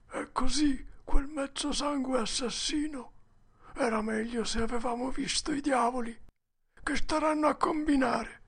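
put-on high, hushed, tense child's voice; frightened boy Huck. A narrator's reading. Huck recognizes the murderer nearby, frightened. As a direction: keep it high and fearful. Huck dreads Injun Joe.

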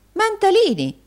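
very high, loud reproachful cry; scandalised wife calling her husband; exclaiming. A narrator's reading. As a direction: call him sharply to order. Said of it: "esclamò Madama".

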